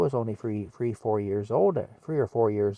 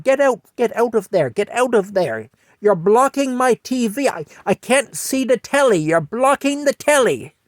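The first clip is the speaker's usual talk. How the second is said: put-on high, loud older British lady shooing a child; Mattie shooing the kid; 12 semitones higher, 6 dB louder than usual. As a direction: fuss at the kid blocking her telly. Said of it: "you know, going".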